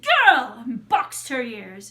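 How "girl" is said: loud high witch's bellow; sharp commanding shout to a servant; Baba Yaga. Acted. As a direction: holler it, falling at the end. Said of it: "Baba Yaga said".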